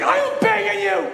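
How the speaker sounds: high, loud, hammed-up pleading; desperate begging. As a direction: plead like a kid on his knees. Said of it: "he's like".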